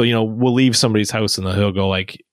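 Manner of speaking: ordinary talk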